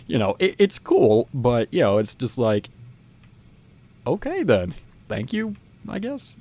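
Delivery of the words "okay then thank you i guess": uncertain thanks. Retold or imitated. Recalling thanks for useless play money, puzzled. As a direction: accept it with doubtful politeness.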